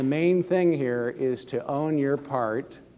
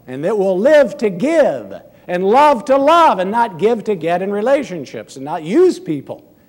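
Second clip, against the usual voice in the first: loud, raised preaching voice; preaching at full force; 6 semitones higher, 9 dB louder than usual. Acted. Pastor urging the church to give freely, preaching loudly. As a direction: preach it out to the congregation.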